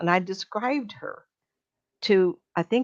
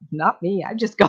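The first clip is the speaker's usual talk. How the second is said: ordinary delivery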